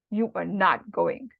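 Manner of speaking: slow, low, stern mother's order; emphatic forbidding. Acted. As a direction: lay down the law, word by word. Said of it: "your mother tells you".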